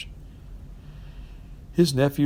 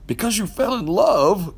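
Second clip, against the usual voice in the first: growled; 10 semitones higher, 3 dB louder than usual. A narrator's reading. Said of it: "growled Scrooge".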